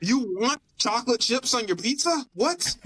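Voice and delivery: high-pitched mock disbelief; hammed-up incredulity, shooting up on the last word; incredulous employee. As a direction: play a baffled pizza worker, voice climbing. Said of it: "imagine being an employee".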